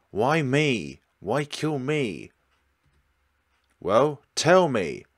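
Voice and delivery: loud, slow, furious demands for an answer. Acted. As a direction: shout the questions, slowing on each. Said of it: "I can understand you're upset, but you are terrifying her".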